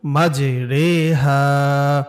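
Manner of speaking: মাঝে রে হা slowly, with রে like an এ-kar sound; re not ri. Shown as a demonstration. Teacher demonstrating the rare রে sound slowly. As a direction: say রে in মাঝে রে হা, never রি. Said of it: "আমরা এটা এভাবে পড়ব".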